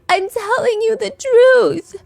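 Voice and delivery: high, slow and trembling; shaky voice. Retold or imitated. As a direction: fake distraught plea. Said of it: "tells her in this shaky voice".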